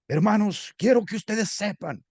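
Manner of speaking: high, slow, declaiming preacher voice; loud preaching opening. Shown as a demonstration. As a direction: open the sermon like an eloquent preacher. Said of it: "¿Lo iniciarían con una voz alta?". (apertura de sermón elocuente y alta)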